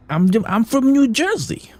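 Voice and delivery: high, slow, exaggerated New Jersey accent. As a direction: draw out the vowels in a mock New Jersey voice. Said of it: "One of my coworkers does sound like that".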